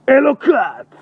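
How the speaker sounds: high, sudden full-voiced shout. Real, not acted. Night walker calling out in the dark street, shouting. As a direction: yell it out at the top of your voice. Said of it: "מה אתה צועק".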